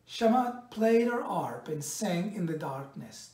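usual speaking voice

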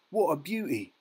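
high-pitched marvelling exclamation; admiring cry. A narrator's reading. Boy admires the swan he has just seen, excited cry. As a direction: marvel aloud, pitched well up. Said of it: "cried Raymond".

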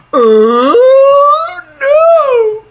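very high, loud shriek of dismay. Real, not acted. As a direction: shriek it in horror. Reacts to shocking game event.

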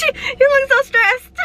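high-pitched, on the edge of laughter; giggly and high; amused teasing. Real, not acted. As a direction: tease while cracking up. Laughing at stressed partner.

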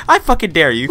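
ordinary talk, nothing marked

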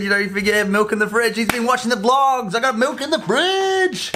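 rising, over-the-top hype; mock outburst. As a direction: start quick, climb to a high stretched finish. Mock outburst about milk to camera.

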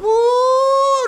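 high, loud worship whoop; joyful whoop. Retold or imitated. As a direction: let out a full-throated shout of joy. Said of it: "see you over in the corner going".

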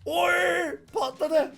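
sudden high, loud yelp; startled cry. Real, not acted. Player startled when the crystal explodes, high yelp. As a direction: jolt as if something blew up.

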